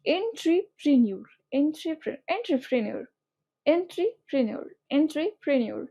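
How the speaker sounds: "এন্ট্রিপ্রেনিউর" in parts, en-tri-pre-niur; spelling pronunciation. Shown as a demonstration. Teacher repeating it as it is spelt, spelling pronunciation. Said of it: "যেভাবে বানানো হয়েছে সেভাবে করতে চাও".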